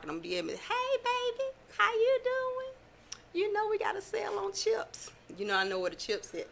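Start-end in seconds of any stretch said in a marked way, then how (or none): 0.6-5.1 much higher, sugary greeter voice, ending in a whisper; sweet Walmart greeter. Pretend. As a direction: play the cheerful old greeter welcoming shoppers. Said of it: "I'm gonna be the greeter at Walmart".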